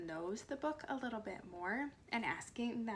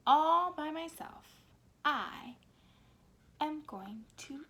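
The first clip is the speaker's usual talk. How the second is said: firm declaration; 5 semitones higher, 7 dB louder than usual. A narrator's reading. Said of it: "the little red hen said".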